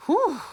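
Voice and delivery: high, blown-out sigh of relief; tired whew. Acted. Toad sighs after the hard garden work, high whew. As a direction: puff out the exhale.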